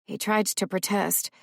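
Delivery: usual speaking voice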